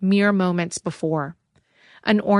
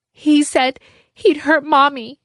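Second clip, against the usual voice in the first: put-on high, loud tearful child; a boy near tears blurting it out; upset child; 8 semitones higher, 6 dB louder than usual. A narrator's reading. Sammy accusing Harrington, tearful child.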